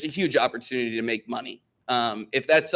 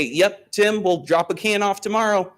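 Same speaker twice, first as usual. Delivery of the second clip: cheerful customer reply; 7 semitones higher, 3 dB louder than usual. Retold or imitated. Imitating his own phone reply.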